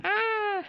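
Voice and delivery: sudden high yelp; startled yelp. Real, not acted. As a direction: yelp as it pricks you. Yelp as piece pokes.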